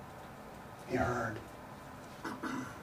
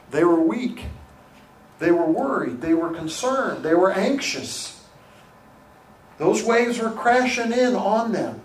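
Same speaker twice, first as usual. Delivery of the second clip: rising intensity; 5 semitones higher, 6 dB louder than usual. Real, not acted. Preacher building to climax.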